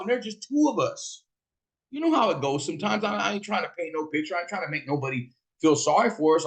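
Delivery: ordinary delivery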